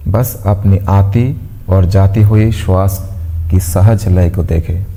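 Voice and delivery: calm, evenly paced meditation voice with long pauses; guided meditation. Acted. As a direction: guide them gently into stillness, pausing between instructions. (शांत, ठहराव भरी ध्यान कराने वाली आवाज़)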